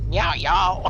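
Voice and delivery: put-on southern accent. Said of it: "speak in a southern accent".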